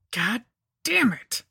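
high, drawn-out curse; frustrated grunt. A narrator's reading. Narrator failing to drive the bone in, cursing with effort. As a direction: force each word out in frustration. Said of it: "I grunted".

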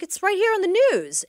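loud, high insistent retelling of the classmate; firmly, above the class noise: it's on the news. Retold or imitated. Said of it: "She's like".

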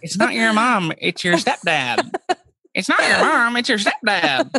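high, hammed-up correcting; mock the salesman's petty correction. Salesman correcting the sister, mock high voice. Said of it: "he was going".

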